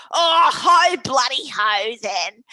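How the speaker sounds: loud mocking shout, slowing at the end; cheeky retort. Auntie mocking her brother's rule, loud cheeky shout. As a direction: fling it back with a laugh in the voice. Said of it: "And she goes".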